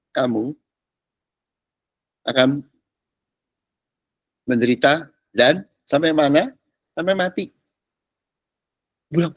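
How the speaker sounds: ordinary talk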